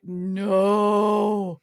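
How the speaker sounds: loud, long drawn-out no; dismayed cry. Retold or imitated. Patients hearing they land at Walter Reed, hammed-up dismay. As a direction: wail it like a crowd in dread. Whole plane's dismay, acted.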